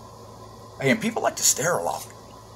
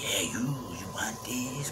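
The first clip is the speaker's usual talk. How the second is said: mock whisper; 13 dB quieter, slower than usual. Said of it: "And she's like".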